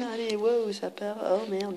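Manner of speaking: ordinary talk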